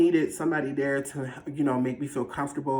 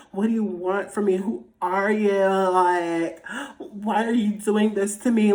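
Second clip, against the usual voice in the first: high, hammed-up tearful wail; dramatic, about to cry; 5 semitones higher than usual. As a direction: plead with it, overacting the tears. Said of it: "Like, I'm finna cry".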